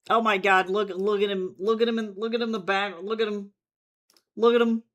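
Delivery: loud, high delighted cries; thrilled shouting; excited squeal. Real, not acted. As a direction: gush over the picture, calling out. Excited over fan art.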